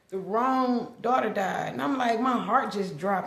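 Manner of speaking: ordinary talk, nothing marked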